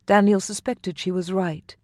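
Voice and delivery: plain reading voice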